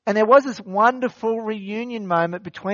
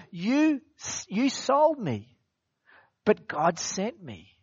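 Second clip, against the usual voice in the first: Joseph's words; 4 semitones higher, 4 dB quieter, slower than usual. Retold or imitated. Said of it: "It's basically".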